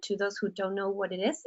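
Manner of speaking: usual speaking voice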